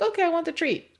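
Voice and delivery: high eager dog voice; voicing the dog. Trainer voicing an eager dog wanting the treat. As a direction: play the dog begging for its treat. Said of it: "Dog comes up, it's like".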